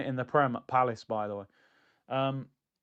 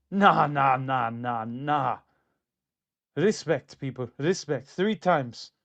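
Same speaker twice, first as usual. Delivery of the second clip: put-on Mourinho accent; 3 semitones lower, slower than usual. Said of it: "That was my Mourinho accent".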